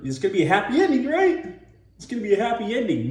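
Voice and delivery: fast, high, eager chatter; mock anxious optimism; hopeful audience. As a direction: rush it high, seeking reassurance. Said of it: "'cause they're like".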